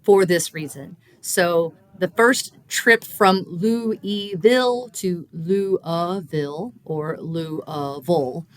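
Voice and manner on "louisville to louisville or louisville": Louisville said slowly, each time with fewer syllables; local Louisville variants. Shown as a demonstration. Linguist to host, slow Louisville pronunciation demo. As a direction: draw out each version of Louisville. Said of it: "both of those last two syllables are unstressed".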